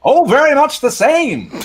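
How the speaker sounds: put-on old newsreel. Shown as a demonstration. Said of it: "what does your newsreel voice sound like".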